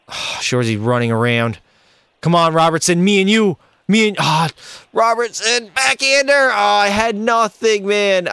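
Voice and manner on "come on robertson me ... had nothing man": frustrated calling. Real, not acted. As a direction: beg a teammate for the pass, then vent.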